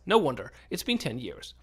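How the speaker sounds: ordinary talk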